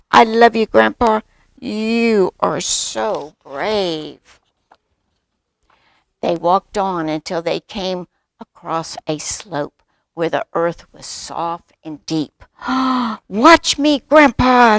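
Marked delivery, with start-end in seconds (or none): 0.1-4.4 loud admiration, slowing to stretched words; adoring little cub. A narrator's reading. Cub praises brave grandpa. 12.5-13.2 big eager intake of breath; excited gasp. A narrator's reading. Narrator gasps for excited cub. 13.3-14.8 high, loud, excited call; eager little cub. A narrator's reading. Cub eagerly shows off to grandpa.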